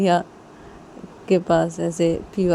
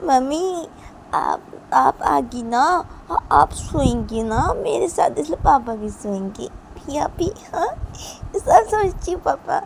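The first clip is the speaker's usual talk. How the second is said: little daughter's voice; 9 semitones higher than usual. Acted. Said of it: "लिया बोलती कि". (छोटी बच्ची की ऊँची आवाज़)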